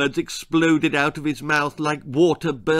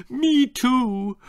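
wailed; 10 semitones higher than usual. A narrator's reading. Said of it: "wailed her mum".

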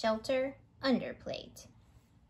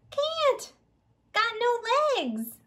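much higher, squeaky little hot dog voice; a cheerful little sausage, pitched way up; lightly voiced hot dog; 10 semitones higher, 4 dB louder than usual. Hot dog character says can't run.

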